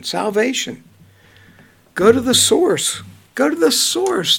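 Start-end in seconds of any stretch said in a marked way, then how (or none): 3.3-4.4 higher emphatic repeat. Real, not acted. Urging the listeners to read the Bible, pitched high and insistent. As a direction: Go to the source pitched much higher.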